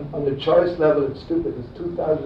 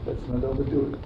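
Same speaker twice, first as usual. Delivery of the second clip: ordinary talk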